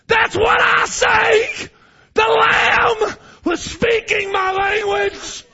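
high, loud, slow, crying proclamation; preacher weeping at full voice; tearful shout. Real, not acted. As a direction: preach it through tears.

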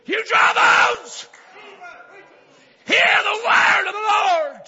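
high, loud, slow cry; preacher's fullest roar; full prophetic shout. Acted. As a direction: thunder it over the valley of bones. Prophet's call, building.

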